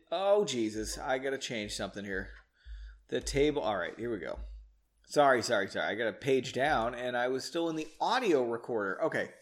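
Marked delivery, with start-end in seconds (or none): none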